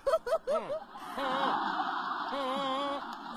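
breathy high cackle; drawn-out giggle; long laugh. Acted. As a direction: burst out laughing. Wife laughs at own joke.

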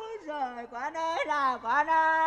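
keen like a mourner, hammed up; mock weeping chant; wailing. Patient wails for his children.

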